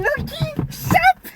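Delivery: high, drawn-out call to the dog. Real, not acted. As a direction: call the dog to fetch, slowly. Calling the dog to fetch.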